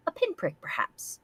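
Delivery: a hushed evil aside; sinister whisper. Acted. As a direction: whisper it menacingly.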